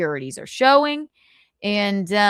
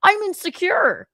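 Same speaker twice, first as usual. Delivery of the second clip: Carl impression; 8 semitones higher, 3 dB louder than usual. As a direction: play him insecure and pitched up. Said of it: "Here's my Carl impression".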